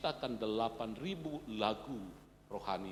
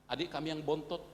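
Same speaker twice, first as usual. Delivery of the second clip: normal talking voice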